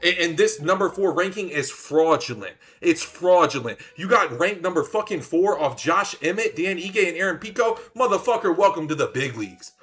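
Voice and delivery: high, loud trash talk; heated mocking rant. Real, not acted. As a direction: roast him hard. Roasting the opponent.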